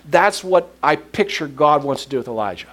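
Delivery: usual speaking voice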